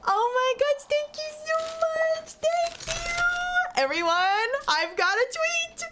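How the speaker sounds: very high, gushing little villager voice; high excited villager. Acted. Villager thanking the player for a gift, very high and gushing. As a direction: gush thanks in a tiny high squeal.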